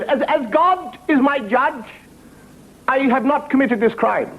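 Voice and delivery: high, slow oath of innocence; solemn defendant. Acted. Defendant answers the judge in court, high solemn plea.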